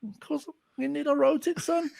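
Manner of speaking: high, teasing brag; mock cocky reply. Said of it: "I went".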